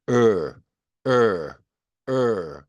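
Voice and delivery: "으" held with the tongue pulled back, like "er"; English R sound. Shown as a demonstration. Teacher demonstrates the R sound to learners, "으" retracted and held. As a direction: curl "으" back into a long, falling r. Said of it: "혀를 뒤로 당긴 상태에서 으 발음을 내면".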